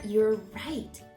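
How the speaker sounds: ordinary delivery, nothing marked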